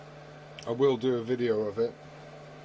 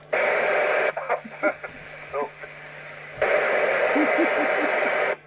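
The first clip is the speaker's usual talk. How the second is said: real laughter rising high and loud; long high laughing fit; 13 semitones higher, 5 dB louder than usual. Real, not acted. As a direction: lose it completely, laughing high. Laughing at imagined disco Bruce.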